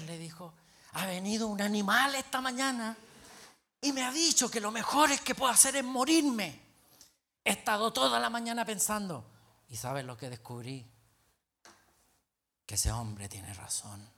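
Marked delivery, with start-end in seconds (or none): 0.9-9.2 loud, high, wounded protest; imitating an offended man's loud lament; aggrieved outburst. Pastor indignantly reports the insult. 9.7-14.1 low, slowing, defeated confession; imitating a despondent man's conclusion; grim resignation. Pastor's resigned confession, acted.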